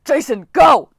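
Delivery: loud, high urgent command; coach yelling. Acted. Coach Hedge yelling at Jason to go save Piper. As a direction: yell the order. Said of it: "Hedge yelled".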